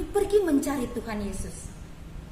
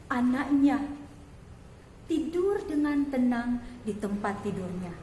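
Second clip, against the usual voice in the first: ordinary delivery, nothing marked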